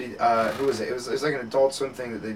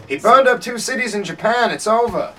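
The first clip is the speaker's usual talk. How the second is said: high, loud, hammed-up announcement; urgent high-pitched mock herald; mock news dispatch; 10 semitones higher, 10 dB louder than usual. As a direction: belt out the pretend wire news. Pretend telegram announcing war news.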